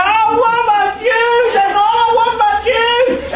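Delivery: high, loud, slow mock-crying child; hammed-up tantrum wail for juice; crying toddler. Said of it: "now they're crying and saying".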